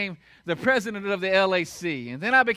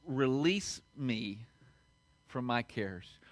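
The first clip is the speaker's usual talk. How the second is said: slow, low, hushed plea to God; prayer; 9 semitones lower, 6 dB quieter, slower than usual. Retold or imitated. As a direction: pray softly, with pauses between phrases. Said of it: "I've started praying this".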